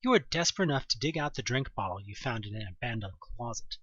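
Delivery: usual speaking voice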